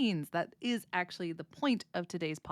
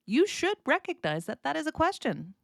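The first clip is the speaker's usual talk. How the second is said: ordinary talk, nothing marked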